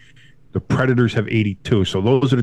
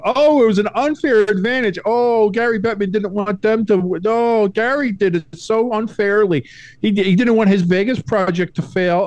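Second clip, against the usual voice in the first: much higher, whining mock complaint; whiny mock scream; 10 semitones higher than usual. Host mimics fans crying unfair advantage, high whine. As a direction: wail it like a sore loser. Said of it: "they tried to scream".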